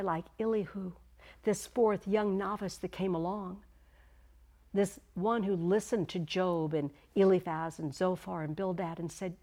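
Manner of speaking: ordinary delivery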